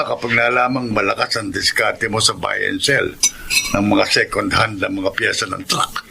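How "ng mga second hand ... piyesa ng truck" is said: ordinary delivery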